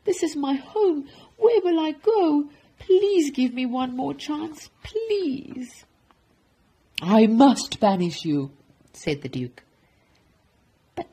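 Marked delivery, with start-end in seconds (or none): 0.0-5.9 high, desperate begging; beg, drawing out the last pleas; pleading. Acted. Baker begging for one more chance, high pleading. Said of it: "pleaded Walter". 7.0-8.5 loud, stern pronouncement; stern sentence. Acted. Duke banishing the baker, stern and loud.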